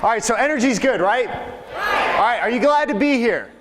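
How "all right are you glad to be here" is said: hype up the crowd. Real, not acted. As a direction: fire up the room. Hyping up the seminar crowd.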